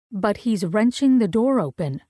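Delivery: plain reading voice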